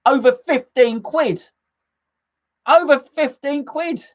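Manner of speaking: high-pitched, slow incredulity; outraged disbelief. Real, not acted. Dad ranting about coffee shop prices, outraged disbelief. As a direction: draw it out, appalled at the price.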